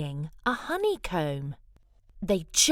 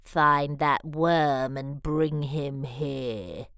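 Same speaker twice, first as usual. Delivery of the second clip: low, slow scheming mutter; a hint of a sly villain; muttering wizard; 6 semitones lower, slower than usual. Wizard lizard to his servant, low slow mutter. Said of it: "He mutters in his servant's ear".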